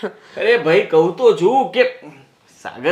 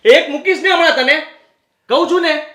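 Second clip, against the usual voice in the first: high, sharp threatening burst; angry threat; 7 semitones higher than usual. Acted. Husband threatening his wife mid-quarrel, angry burst. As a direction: threaten her in a flash of temper.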